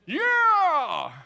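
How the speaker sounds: a sudden loud, high "Yeah!"; excited whoop. Retold or imitated. Greedy soldier hearing the reward, loud whoop. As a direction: whoop like you just won the prize.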